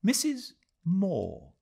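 put-on high, slow elderly lady's voice; old woman voice. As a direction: reveal herself as an old woman. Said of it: "with a change of voice".

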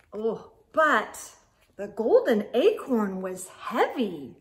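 usual speaking voice

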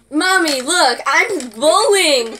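put-on excited kid calling to mommy, drawn out; playing a kid. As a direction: act like a little kid wanting attention. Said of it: "literally imagine a kid just kind of".

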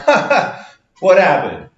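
high, amused what happened; laughing it off. Retold or imitated. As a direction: chuckle through the question. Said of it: "You're not sitting there going".

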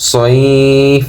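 "সাইফ" drawn out and ending on a bare f; chanted "সাইফ" without an ending vowel; pausal pronunciation. Shown as a demonstration. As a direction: stretch the glide in "সাইফ", stop on f. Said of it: "এরকম উচ্চারণ হবে".

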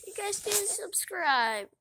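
loud, high fake wail; mock crying. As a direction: drag it out like a pretend bawl. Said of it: "now I'm gonna cry".

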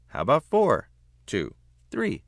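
put-on high, loud haggling little girl; bargaining child. Olivia bargaining with mother.

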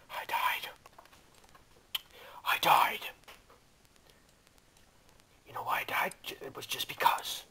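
hushed, drained whisper. Real, not acted. Player tells viewers why he died, whispering.

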